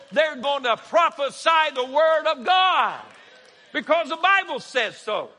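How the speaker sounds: loud, high preacher's cry; shouted climax of the sermon; preaching shout. Real, not acted. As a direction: drive it home with a shout.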